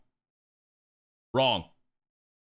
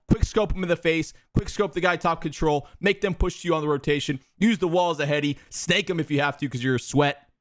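ordinary talk

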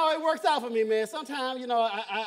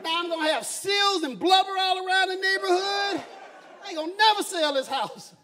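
louder, ranting Southern neighbor; 5 semitones higher, 6 dB louder than usual. Retold or imitated. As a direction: complain like a bigoted neighbor. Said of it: "looking out his window and go".

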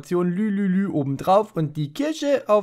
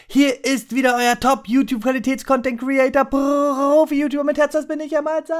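hammed-up intro voice; 9 semitones higher than usual. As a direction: announce yourself like a proud host. Übertriebene YouTuber-Begrüßung. (übertriebene Moderatorenstimme)

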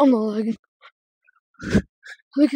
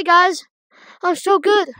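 normal talking voice

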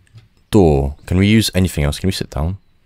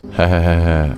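ordinary delivery

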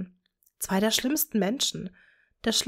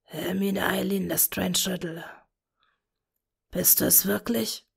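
broken whisper. A narrator's reading. Tom to his lost daughter, broken whisper. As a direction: barely get the words out. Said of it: "flüsterte mit gebrochener Stimme".

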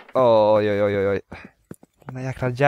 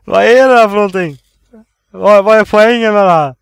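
frustrated outburst; 6 semitones higher, 9 dB louder than usual. Real, not acted. Frustrated at game puzzle.

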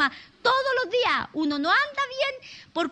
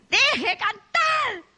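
very slow, drawn-out, higher, louder shout; furious yell; 6 semitones higher, 3 dB louder, much slower than usual. Retold or imitated. Angry old man shouting to stop the singing, drawn-out yell. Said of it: "viene gritando el viejo".

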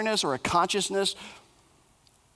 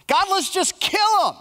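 much higher, louder and slow, drawn-out mock rage; hammed-up furious plea; 13 semitones higher, 4 dB louder than usual. Preacher acting Jeremiah's closet talk, big slow mock outburst. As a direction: whine it up to God like a secret tantrum. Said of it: "in the closet talk".

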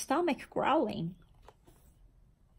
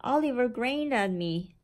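ordinary delivery, nothing marked

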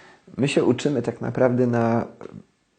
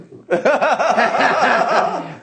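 loud high laugh; burst of laughter; 9 dB louder than usual. Real, not acted. Laughs at mother working in tax office.

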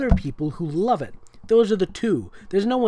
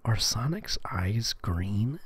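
slow whispered aside; 5 semitones lower, 6 dB quieter, much slower than usual. Real, not acted. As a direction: whisper the question slowly.

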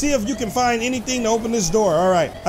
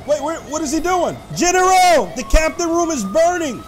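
alarmed shout; 5 semitones higher than usual. Pretend. Cockpit catches fire, alarm.

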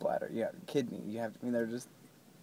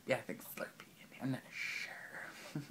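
whisper; 11 dB quieter than usual. Real, not acted. Whispered aside.

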